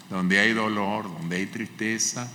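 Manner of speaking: normal talking voice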